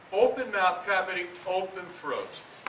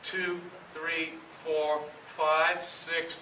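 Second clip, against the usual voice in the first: ordinary talk, nothing marked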